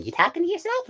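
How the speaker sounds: very high-pitched questioning voice; pry in a squeaky, rising voice; acted prying character. Crewmate asks the space ranger, high prying voice.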